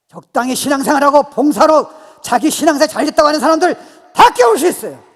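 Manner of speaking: high, sustained shouting cadence; full-force preaching. Real, not acted. Preacher declaring whom he can awaken, full-force shout. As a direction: shout each kind of person out.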